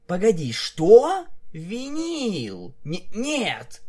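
high, startled objection; shocked protest. A narrator's reading. Narrator mare balks at Vinyl's kiss idea, shocked. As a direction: blurt it out, aghast.

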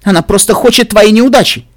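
high, loud accusing voice; insistent. A narrator's reading. Potted plant accuses Pinkie, insistent. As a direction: press the warning hard. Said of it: "настаивало растение в горшке".

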